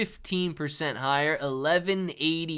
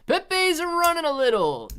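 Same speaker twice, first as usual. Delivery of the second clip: excited; 7 dB louder than usual. Real, not acted. Excited crypto stream shout.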